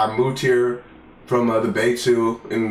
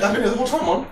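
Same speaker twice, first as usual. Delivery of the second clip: high, sweet fake innocence to mom; lightly voiced innocent teen; mock innocent kid; 10 semitones higher, much faster than usual. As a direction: play it innocent, pitched up. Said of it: "And you're like".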